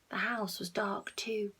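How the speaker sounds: usual speaking voice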